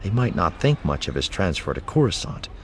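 usual speaking voice

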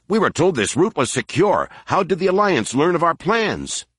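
high, pressing panicked voice; panicked outrage. A narrator's reading. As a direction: demand answers in rising panic. Brigader bursts in, panicked accusation.

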